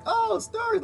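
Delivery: high mock wide-eyed voice; lightly voiced naive surprise; mock wonder. A confused user mocking his own wonder at Facebook stories, high mock voice.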